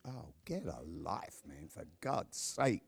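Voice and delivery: low, slow scoffing aside; mock dismissive scoff. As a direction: brush it off like a fed-up cynic. Mocking an imagined dismissive reply.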